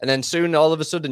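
normal talking voice

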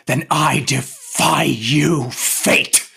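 slow, loud defiance; defiant cry. Acted. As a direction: hurl it at the heavens. Romeo rages against fate.

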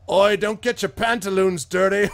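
loud, high jeering shout; a tavern patron mocking the snooty messenger, loud and high; heckling patron. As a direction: jeer at him across the tavern.

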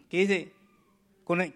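ordinary talk

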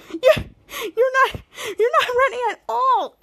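high, put-on derisive voice; laughing mockery. Miles tells Bose he isn't ready, laughing mockery. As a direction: jeer through imitated laughter. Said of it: "Miles begins to laugh".

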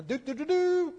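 high sung trumpet call, hammed-up; mock trumpet fanfare. Preacher imitating a king's trumpet, badly faked fanfare. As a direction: toot it like a bad herald's trumpet. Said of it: "that wasn't a very good trumpet".

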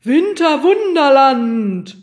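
drawn-out, high fiendish holler; teuflischer Ausruf. A narrator's reading. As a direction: belt it out slowly like a gleeful villain. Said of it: "lachte er teuflisch und rief". (teuflisch gerufen, laut und langgezogen)

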